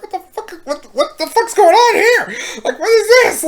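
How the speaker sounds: high-pitched mock shriek of shock; shocked and embarrassed. Girl walking in on the morning scene, hammed-up shock. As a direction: imitate a scandalized girl, voice leaping way up. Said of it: "she's, like, shocked and embarrassed".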